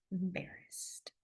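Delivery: unvoiced, slow whisper; whispered demo. Shown as a demonstration. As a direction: breathe the word out. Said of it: "the red is coming up your face".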